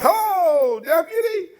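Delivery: high, loud, laughing sing-song hello; mock polite greeting. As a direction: greet the deputy with fake sweetness. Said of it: "Like some real Mayberry shit".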